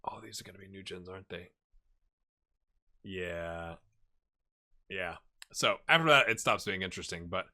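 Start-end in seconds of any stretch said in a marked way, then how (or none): 0.1-5.2 very soft, low, fast near-whisper; hushed, breath-only aside; muttered realization. Real, not acted. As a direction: murmur it to yourself under your breath. Muttered realization to self while scrolling.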